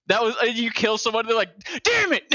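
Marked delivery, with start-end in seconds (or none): 1.8-2.2 high, loud imitated curse of a beaten player; a hammed-up frustrated gamer yelp; mock angry outburst. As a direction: squeal it like you just got killed. Killed player's angry outburst retold.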